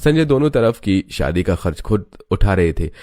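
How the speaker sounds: ordinary delivery, nothing marked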